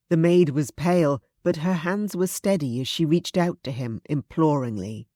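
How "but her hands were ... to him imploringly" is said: ordinary delivery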